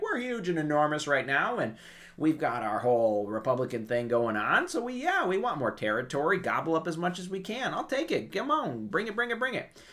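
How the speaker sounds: high, eager, grabbing voice; mock greedy France. France eagerly accepting Corsica, high and greedy. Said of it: "France is like".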